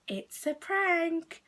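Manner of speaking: high, drawn-out singsong reveal; teasing, slow-motion gotcha; gleeful prank reveal. Retold or imitated. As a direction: stretch it out slowly, pitched high.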